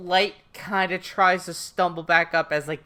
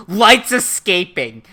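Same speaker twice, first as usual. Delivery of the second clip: loud, drawn-out warning cry; sudden mock yell of alarm; imitated alarmed shout; 9 dB louder than usual. Voicing wide-eyed Matsuda calling out.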